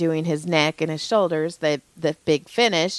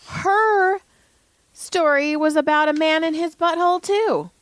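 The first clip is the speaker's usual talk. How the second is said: much higher, giddy storytelling; excited disbelief; 9 semitones higher than usual. Real, not acted. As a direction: gush it out high and amazed. Excited gossip about nurse story.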